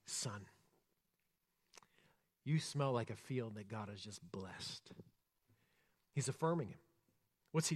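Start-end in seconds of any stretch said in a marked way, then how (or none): 0.1-4.9 slow, soft and hushed blessing; tender father. Acted. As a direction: savour each word like a proud old father. Isaac blesses his son tenderly.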